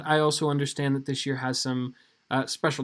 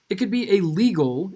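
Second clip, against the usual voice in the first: LEGAL punched louder than usual; stress on LEGAL; 3 semitones higher, 5 dB louder than usual. Real, not acted. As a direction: lean hard on the word "legal". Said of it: "I emphasize the word legal".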